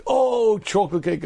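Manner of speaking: mock longing moan; a hammed-up moan of craving; a retold group moan for chocolate cake. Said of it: "I hear the moans".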